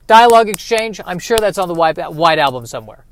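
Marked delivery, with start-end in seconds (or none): none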